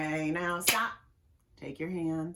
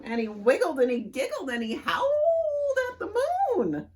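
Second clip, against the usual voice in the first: giggly; 12 semitones higher, 6 dB louder, faster than usual. A narrator's reading. Silly story line, giggling.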